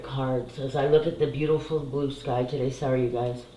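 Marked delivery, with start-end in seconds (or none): 2.7-3.5 crying. Real, not acted. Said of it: "I'm not sorry I cry".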